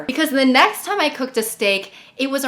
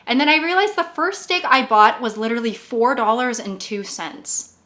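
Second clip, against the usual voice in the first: ordinary talk, nothing marked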